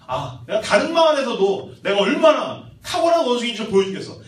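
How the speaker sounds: storybook show-off; boastful monkey. Acted. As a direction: announce your greatness with gusto.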